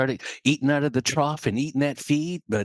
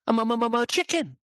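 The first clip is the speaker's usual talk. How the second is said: high, nervous stutter; acted frightened little bird; stammering chick; 8 semitones higher than usual. Timid chick stammers to eagle.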